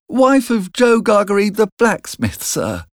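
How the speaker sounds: put-on high child's voice, dropping on the last word; frightened boy. A narrator's reading. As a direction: explain timidly to the convict. Frightened boy explains to convict.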